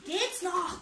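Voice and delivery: understated yell at the assistant; bellow. Acted. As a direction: snap it out as an outraged shout. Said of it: "brüllte ich".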